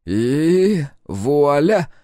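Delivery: loud, high, drawn-out flourish; triumphant and very slow, ringing out; joyful exclamation. A narrator's reading. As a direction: stretch it out like a magician's reveal. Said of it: "радостно воскликнул Сальф".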